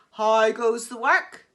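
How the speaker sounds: loud bossy farmer call; lazy farmer bellowing from bed. Acted.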